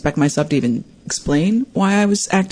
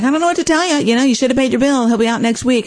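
higher-pitched dismissive clerk imitation; unhelpful clerk; 9 semitones higher, faster than usual. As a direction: lightly mimic a brush-off over the phone. Retold unhelpful gas company clerk.

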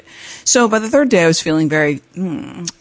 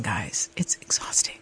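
hushed weary aside; 9 semitones lower, 14 dB quieter than usual. Retold or imitated. Skier tells listeners of the exhausting climb, hushed aside. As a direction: drop to a near-whisper. Confides how exhausting it was.

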